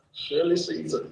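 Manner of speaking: ordinary talk, nothing marked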